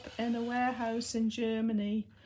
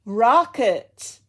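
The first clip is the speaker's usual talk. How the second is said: "Rocket" called out loud in an American accent; a loud American-accented "Rocket" with a falling end; 12 dB louder than usual. Shown as a demonstration. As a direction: call the dog's name with an American accent, American r and open "Rah". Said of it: "try and say it with an American accent".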